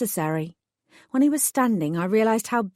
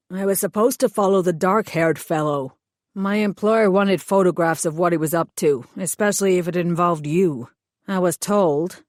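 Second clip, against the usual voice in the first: hired thug voice; 3 dB louder than usual. Acted. Thug explains his orders to the heroine, sullen lowered voice. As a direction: play the sulky hired heavy explaining himself.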